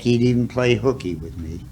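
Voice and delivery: usual speaking voice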